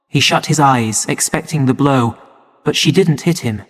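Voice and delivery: plain reading voice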